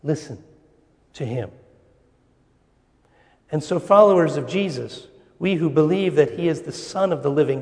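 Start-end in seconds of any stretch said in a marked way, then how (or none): 0.0-1.5 hushed listen to him. Shown as a demonstration. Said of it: "The voice says".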